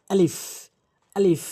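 teacher modelling আলিফ for students to copy; আলিফ pronounced carefully, letter by letter; Arabic letter alif. Shown as a demonstration. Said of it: "এটি এভাবেই বলতে হবে".

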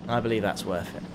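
quiet aside. Real, not acted. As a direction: say it under your breath, low. Repeats himself as an aside.

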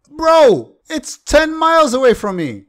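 loud, high outburst at the game; exasperated yell. Real, not acted. Gamer yelling at a faraway boss, exasperated shout. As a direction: yell in disbelief at the screen.

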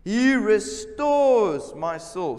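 slow, loud, solemn proclamation; slow emphatic scripture. Acted. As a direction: stretch out every word of the line.

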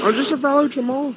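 high goofy voice; silly put-on voice. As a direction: play dumb to the monster. Talking to monster in game.

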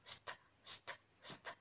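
"st" hissed without voice, as separate short sounds; isolated consonant cluster. Shown as a demonstration. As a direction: give just the "st" cluster, voiceless. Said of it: "Có phụ âm đôi". (bật riêng phụ âm đôi "st", không thành tiếng)

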